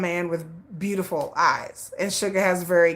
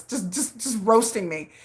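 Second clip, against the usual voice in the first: ordinary delivery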